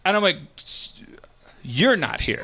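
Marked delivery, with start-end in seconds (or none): 1.6-2.4 low, understated comeback; deadpan retort. Retold or imitated. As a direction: answer back dryly in a lowered voice. Teller's deadpan reply to driver.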